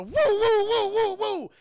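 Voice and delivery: high, loud siren wail; imitated police siren. Cops racing past Charlie, wailing siren imitation. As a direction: whoop like passing cop cars, easing off at the end. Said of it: "All these fucking cops go".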